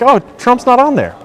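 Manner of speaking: high-pitched mock surprise; imagined objector. Voicing a viewer who might object, high-pitched mock protest.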